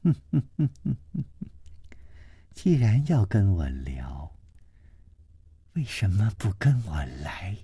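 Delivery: fast giggle then soft whisper; flirtatious hushed invitation; teasing laugh. A narrator's reading. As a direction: lure him with a coy whispered laugh. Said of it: "而且还笑了笑说".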